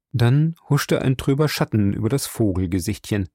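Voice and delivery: ordinary delivery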